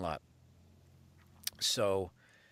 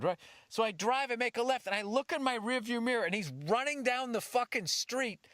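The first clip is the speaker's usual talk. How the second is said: ordinary talk, nothing marked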